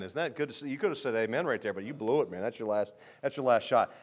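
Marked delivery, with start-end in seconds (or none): none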